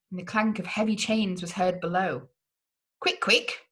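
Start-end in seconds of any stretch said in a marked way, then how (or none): 3.0-3.7 high-pitched urging; urgent cry. A narrator's reading. Nerissa hurrying the prisoner away, urgent high cry. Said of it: "she cried".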